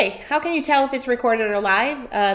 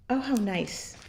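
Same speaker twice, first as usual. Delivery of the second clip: ordinary talk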